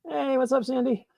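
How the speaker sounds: normal talking voice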